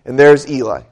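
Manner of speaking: ordinary talk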